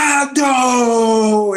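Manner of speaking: slow, stretched happy shout; retold yell. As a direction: bellow it like the barista. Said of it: "Ele deu um berro".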